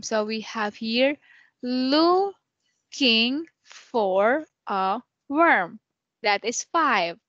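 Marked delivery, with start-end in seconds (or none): 1.6-5.8 looking for a worm drawn out word by word; slow syllable count. Shown as a demonstration. Teacher counting syllables slowly for Ken. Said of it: "use five syllables again".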